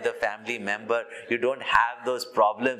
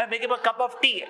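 mock indignant echo; 11 semitones higher, much faster than usual. Swami mocking the wife's protest.

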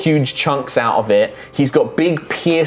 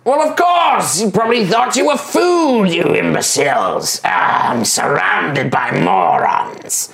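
scholarly goblin scorning the adventurers who killed Gleck; smart goblin; 6 semitones higher than usual. Acted. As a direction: talk down to them like a clever, weary goblin. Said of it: "the other goblin is like".

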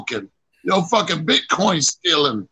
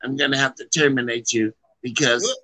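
mock a regretful boss, voice dropped; 7 semitones lower than usual. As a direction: deliver the firing low and measured.

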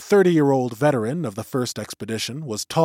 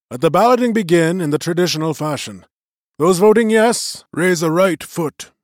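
lightly voiced elder, raised and formal; magician's voice; 6 semitones higher, 6 dB louder than usual. A narrator's reading. Old magician opening the vote to the clan, lightly voiced and raised. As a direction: address the gathering like a presiding elder.

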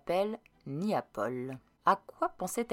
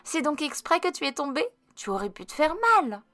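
lightly voiced child, higher; a boy's voice, a touch high; 9 semitones higher than usual. A narrator's reading. Boy to girl who fell, lightly childlike. Child character speaking in story.

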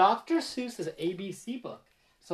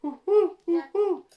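high owl hoots; 10 semitones higher, 4 dB louder than usual. Acted. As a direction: hoot like an owl. Reviewing owl sound.

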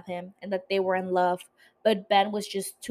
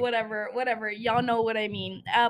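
ordinary talk, nothing marked

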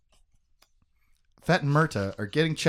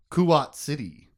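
ordinary delivery

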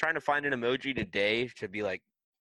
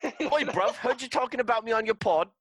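high, loud hammed-up London geezer; squaring up like an angry British lad; mock British accent; 12 semitones higher, 5 dB louder than usual. Co-host playing an offended Brit calling out the podcast, mock London accent.

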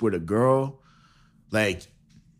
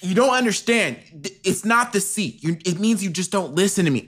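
nagging wife; 6 semitones higher than usual. Pretend. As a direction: scold him like a fed-up wife. Improvised Mars couple breakup.

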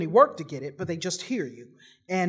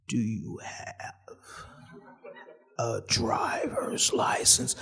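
much lower, very slow and softer, half breathless; slow officer voice; 9 semitones lower, 6 dB quieter, much slower than usual. Retold or imitated. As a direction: stretch every word like a drugged-out cop. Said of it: "he sounded like this".

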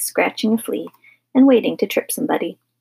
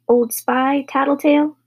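higher, mild taunting shout; 6 semitones higher than usual. A narrator's reading. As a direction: hurl a name at a sister. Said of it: "Philip shouted".

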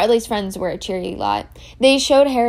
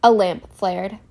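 usual speaking voice

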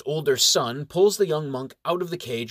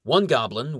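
plain reading voice

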